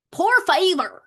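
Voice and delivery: high, loud Southern twang on Spanish; hammed-up Southern-accented Spanish. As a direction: belt it out in a playful Southern accent. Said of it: "let me do my Southern voice".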